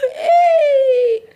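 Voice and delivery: high, loud laughing shout. Real, not acted. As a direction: burst out with a joyful laughing yell. Excited shout at crush news.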